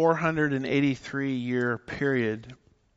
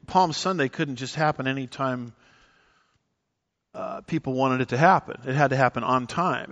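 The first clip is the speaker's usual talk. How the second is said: ordinary talk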